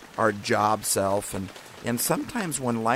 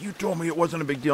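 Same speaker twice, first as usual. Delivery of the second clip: imitating mortified disbelief, pitched up; almost mortified; 7 semitones higher than usual. Man seeing the bruise on my leg, almost mortified. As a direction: retell his dismayed protest in a higher voice. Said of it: "like, almost mortified".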